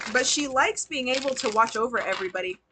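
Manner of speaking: ordinary talk, nothing marked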